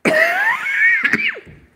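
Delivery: loud cough from a cold; hacking cough, sick. Real, not acted. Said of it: "Sorry again, cold".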